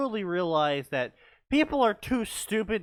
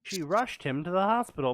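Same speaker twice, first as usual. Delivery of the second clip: usual speaking voice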